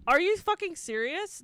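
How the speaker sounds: loud, high disbelief; incredulous. Real, not acted. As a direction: exclaim it in disbelief. Incredulous outburst.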